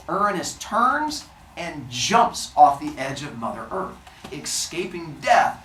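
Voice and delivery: ordinary delivery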